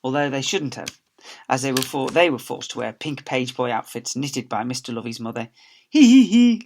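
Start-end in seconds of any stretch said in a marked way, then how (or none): 5.9-6.6 high, loud hee hee; giggling laugh. Acted. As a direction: giggle at the boys. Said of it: "laughed the girls".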